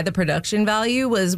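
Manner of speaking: normal talking voice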